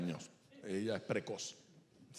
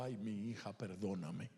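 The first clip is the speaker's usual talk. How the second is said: a hint of a weeping father, much softer; tearful father; 5 semitones lower, 8 dB quieter than usual. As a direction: murmur the plea like a broken dad. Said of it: "comenzó a llorar y le dijo".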